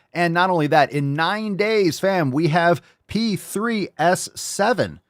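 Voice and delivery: high, slow drawn-out announcement; hyped release tease. Real, not acted. Host hyping the upcoming release to the fam, high and drawn out. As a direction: build it up like a big reveal.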